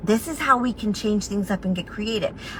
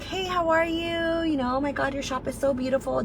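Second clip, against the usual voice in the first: bubbly greeting; 5 semitones higher, 6 dB quieter than usual. Retold or imitated. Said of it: "I'm like".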